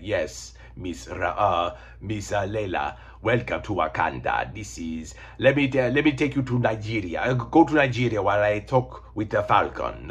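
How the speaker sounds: put-on polite, halting Wakandan accent; a courteous gentleman with a put-on Wakandan accent. T'Challa welcoming Layla to Wakanda, polite Wakandan accent. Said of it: "That's my Wakandan accent".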